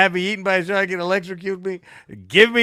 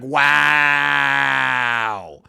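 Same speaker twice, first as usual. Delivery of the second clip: mock amazement; 4 semitones lower than usual. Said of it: "amazed at glasses".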